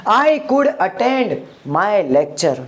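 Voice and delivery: slow, careful English sentence; slow model sentence. Shown as a demonstration. Teacher models the English sentence slowly for students. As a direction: say each word slowly for the class to copy. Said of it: "जाने की संभावना थी".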